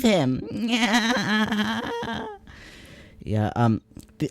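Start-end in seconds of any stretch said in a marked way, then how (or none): 0.5-2.3 put-on mean girl giggle. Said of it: "mock mean girl laugh".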